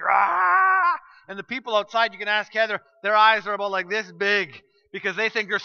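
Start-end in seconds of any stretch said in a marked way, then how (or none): 0.0-1.0 crocodile scream. Retold or imitated. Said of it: "and he's screaming".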